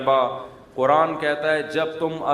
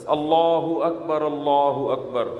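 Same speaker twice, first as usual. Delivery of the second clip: slow, measured short takbir; a short, measured azan; short azan demo; slower than usual. Shown as a demonstration. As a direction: call it briefly, without stretching. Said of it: "مختصر اذان دیں".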